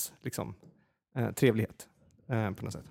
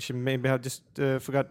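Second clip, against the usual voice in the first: normal talking voice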